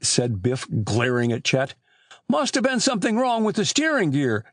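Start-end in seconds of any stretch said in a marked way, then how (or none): 2.3-4.5 higher-pitched, feeble explanation; mildly defensive, pitched up; weak excuse. A narrator's reading. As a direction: offer a lame excuse in a raised pitch. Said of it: "Chet explained weakly".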